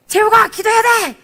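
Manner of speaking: loud, high call to pray harder; urging shout. Retold or imitated. Pastor shouting to push a student to focus. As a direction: push him on with a ringing cry. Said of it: "딱 그랬는데".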